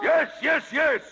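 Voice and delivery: gloating insistence. Acted. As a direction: taunt him right back. Stromboli answers the puppet.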